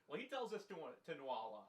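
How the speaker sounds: normal talking voice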